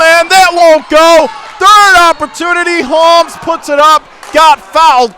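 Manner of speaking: high, loud, building then easing at the line; excited call. Real, not acted. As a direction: ride the scramble with rising shouts. Scramble under the basket.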